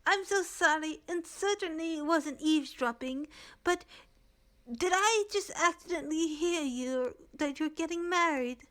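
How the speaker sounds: high, gushing Renza; Renza's voice found. Shown as a demonstration. GM finally nails Renza's high gushing voice. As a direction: pitch it up and gush. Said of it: "oh, there we go".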